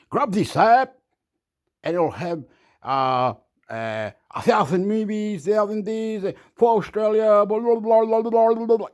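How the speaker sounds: lightly hammed-up app advert pitch; mock ad voice. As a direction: rattle it off like a cheesy ad, then trail into blah blah. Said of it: "a special app that says".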